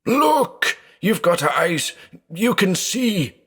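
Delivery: very high, desperate cry to his brother; panicked shout. A narrator's reading. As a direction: beg him to look, frantic.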